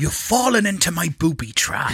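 put-on rural Somerset accent; West Country accent. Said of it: "Uh, West Country".

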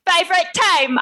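high, botched Cockney; overdone Cockney vowel on "team"; Cockney accent. Shown as a demonstration. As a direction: pitch it up into bad Cockney. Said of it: "I'm always going Cockney".

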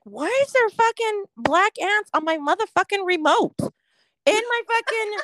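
high-pitched, bewildered complaint; comic indignant outburst; exasperated disbelief. Retold or imitated. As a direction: rant in disbelief, acting out the discovery. Retold discovery of ants on remote.

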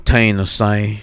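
"Tennessee" in a Southern drawl; lightly mock Tennessee accent. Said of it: "That's my Tennessee accent".

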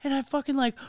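usual speaking voice

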